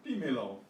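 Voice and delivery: dímelo, stressed on the opening DÍ; stress on first syllable. Shown as a demonstration. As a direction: punch the first syllable of dímelo, let the rest drop. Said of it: "keep the stress on the same syllable".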